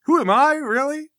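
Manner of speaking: loud, high disbelief; acted outrage at not being recognized; incredulous affront. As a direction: throw the question back, high and loud. Armsman affronted at being challenged.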